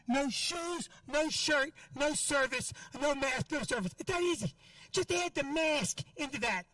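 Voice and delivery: ordinary talk, nothing marked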